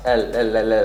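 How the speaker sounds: light clear L, tongue forward. Shown as a demonstration. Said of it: "you want the L to be very light".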